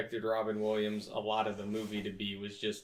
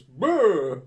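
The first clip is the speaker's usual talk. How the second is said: lips trilled loud brr; loud high brr noise; mock shiver sound; 7 semitones higher, 14 dB louder than usual. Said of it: "Then he goes".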